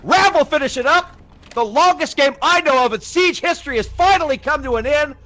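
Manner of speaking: high, loud triumphant final call; climactic play-by-play. Real, not acted. Caster ends the longest game in Siege history, climactic excited call. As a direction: belt out the match-winning moment. Caster calls the winning moment.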